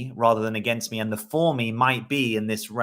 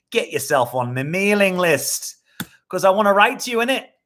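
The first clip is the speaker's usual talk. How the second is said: put-on vague British accent, higher and louder; hammy British accent, said to yourself; unspecified UK accent; 8 semitones higher, 6 dB louder than usual. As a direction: put on a jokey UK accent for the plug. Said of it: "I don't know where that accent's come from".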